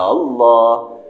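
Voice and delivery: Allah with the alif drawn out long and loud. Shown as a demonstration. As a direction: stretch the a of Allah far too long. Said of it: "Memanjangkan sebutan alif pada Allah".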